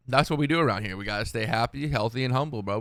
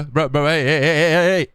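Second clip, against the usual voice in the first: loud hey hey shouting; 7 dB louder than usual. Retold or imitated. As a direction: yell hey over and over. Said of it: "randoms in Call of Duty that's like".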